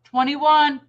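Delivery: called out high and loud. Retold or imitated. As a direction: sing out the number loudly. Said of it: "two of the other ladies went".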